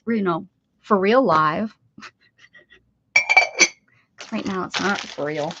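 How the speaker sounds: normal talking voice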